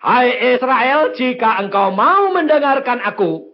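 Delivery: loud, high exhortation; full-force preaching. Real, not acted. Preacher urging believers to endure testing, full-force preaching. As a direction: urge the congregation at full volume.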